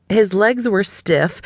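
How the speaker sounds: plain reading voice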